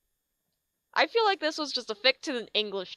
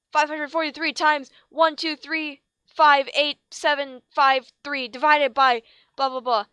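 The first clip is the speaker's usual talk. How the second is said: villain teacher rambling; 5 semitones higher, 6 dB louder than usual. Acted. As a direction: drone through the numbers.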